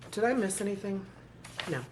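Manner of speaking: usual speaking voice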